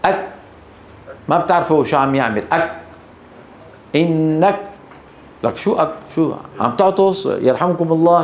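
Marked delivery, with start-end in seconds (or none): 0.1-0.3 أك cut dead, no release on the k; soundless kaf. Retold or imitated. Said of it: "الكاف الصماء". 2.5-4.6 أك and إنك choked off at the k; soundless kaf. Retold or imitated. Teacher mimics the faulty mute kaf, soundless. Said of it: "الصماء يعني ما له صوت".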